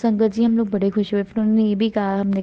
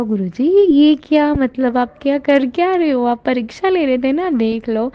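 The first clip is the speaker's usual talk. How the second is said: higher, louder astonished outcry to Guruji; half-laughing astonished exclamation, much higher and louder; amazed; 5 semitones higher, 3 dB louder than usual. Retold or imitated. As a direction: gasp in disbelief at the sudden sun. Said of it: "और मैं हैरान".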